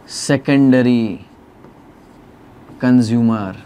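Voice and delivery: drawn-out words with a long pause between; very slow, word-by-word term read-out; slow dictation. Shown as a demonstration. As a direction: spell it out slowly as you write. Teacher dictating while writing.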